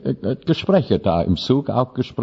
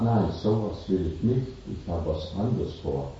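low, soft, calm Death; Death speaking; 3 semitones lower, 8 dB quieter than usual. Acted. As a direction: quietly claim her as Death. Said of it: "Er sagt". (leiser Tod, der das Milchmädchen beruhigt)

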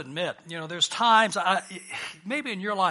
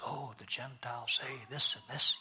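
hushed aside among friends; whispered gossip; 7 semitones lower, 12 dB quieter than usual. Acted. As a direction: whisper it secretively, low and soft. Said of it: "Maybe they whispered them among their friends".

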